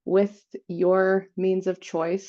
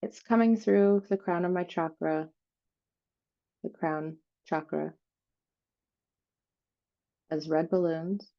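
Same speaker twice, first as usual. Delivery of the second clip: guided meditation; 3 dB quieter than usual. Real, not acted. Host leading listeners through an energy cleansing, guided meditation. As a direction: softly guide them down through the body, phrase by phrase.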